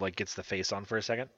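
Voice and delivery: ordinary talk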